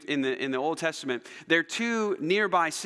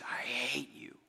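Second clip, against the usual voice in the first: hushed hurt whisper; 3 semitones lower, 10 dB quieter, slower than usual. Acted. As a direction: whisper it, barely any voice. God's pained quote of Israel's words.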